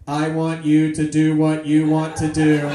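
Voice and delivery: loud robot monotone; mechanical robot voice. Acted. Passive-aggressive robot to departing lover, loud mechanical monotone. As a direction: hammer each word out on one note. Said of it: "that same mechanical voice".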